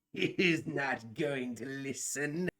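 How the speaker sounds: put-on slow, soft creaky old man; a hint of an old man, slow. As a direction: mutter it like an old man in the hallway. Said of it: "what sounds like an old man kinda cack".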